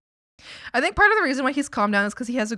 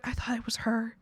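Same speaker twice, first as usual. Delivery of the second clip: whispered, mock-sad brother; a hushed imitation of a heartbroken sibling; whispered heartbreak; 7 dB quieter than usual. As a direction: breathe it out like a crushed little brother. Imitating sad brother after breakup.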